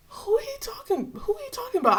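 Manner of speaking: high, astonished who-is-he-talking-about; incredulous disbelief. Retold or imitated. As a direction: react like you can't believe what you just heard. Stunned by professor's claim.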